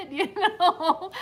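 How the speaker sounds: high-pitched laughing aside. Real, not acted. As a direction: laugh through the words. Laughing at own retold story.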